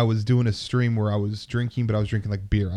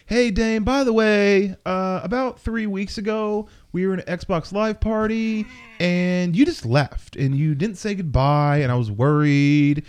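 higher-pitched, drawn-out imitation of a hurt kid; awkward nerdy kid; 7 semitones higher than usual. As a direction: mimic a needy kid slowly working up to a complaint. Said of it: "most awkward, nerdiest voice ever".

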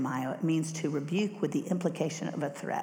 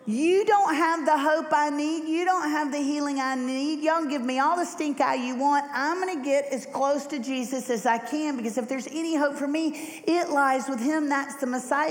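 higher, raised defiant voice of Bart; defiant beggar; 8 semitones higher than usual. Acted. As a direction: stand your ground against the crowd. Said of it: "He says".

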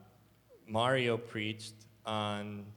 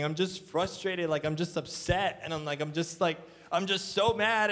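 raised pitch, building exasperation; retold frustrated venting; 6 semitones higher than usual. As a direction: vent it upward, reliving the upset. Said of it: "I'm just start crying".

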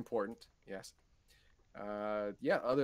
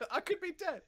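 mock alarm.

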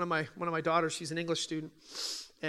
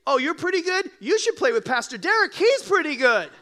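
much higher and louder, gushing mock fan; naive fan; 9 semitones higher, 9 dB louder than usual. Passenger telling LeBron about Pastor Derek, gushing and high. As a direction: play the clueless passenger, gushing. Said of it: "It would be wrong for you to say".

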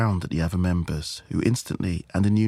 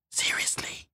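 hushed, voiceless single word; whisper; 6 dB quieter than usual. Acted. Friend after the eerie warning, whispering. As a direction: whisper it under the breath.

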